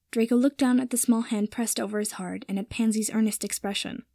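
ordinary delivery

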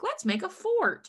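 high, eager playful proposal; imitating an upbeat guy; excited suggestion. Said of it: "and is like".

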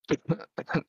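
real coughing fit. Real, not acted. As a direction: cough hard and dry from a cold. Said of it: "jeez, I can't stop coughing".

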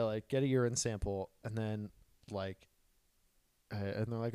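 normal talking voice

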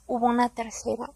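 unvoiced, hushed confession; whisper. Real, not acted. Telling viewers about the third time he hurt her, whispered. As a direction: drop to a whisper as if it hurts to say.

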